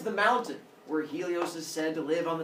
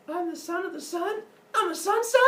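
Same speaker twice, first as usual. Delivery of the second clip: put-on very high, building little-boy voice; amazed child. Son amazed at his mother's news, high excited voice.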